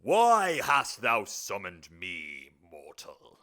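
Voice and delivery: booming slow demon, cracking at the start. Acted. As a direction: bellow slowly, then drop low on the last word. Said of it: "my voice cracked".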